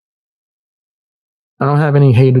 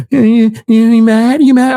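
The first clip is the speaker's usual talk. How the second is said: mock taunt; 7 semitones higher than usual. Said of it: "And he's like".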